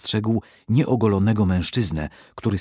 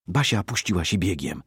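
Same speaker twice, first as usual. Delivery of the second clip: ordinary delivery, nothing marked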